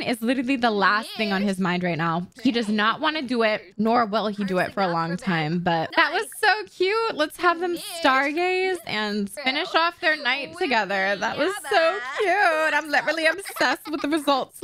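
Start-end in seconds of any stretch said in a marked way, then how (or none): 6.0-14.6 gushing delight. Real, not acted. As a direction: gush over something adorable. Gushing over cute game moment.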